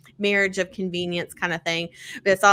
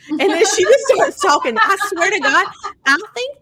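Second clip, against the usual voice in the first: laughing; 11 semitones higher, 8 dB louder than usual. Real, not acted. Gushing about the audiobook.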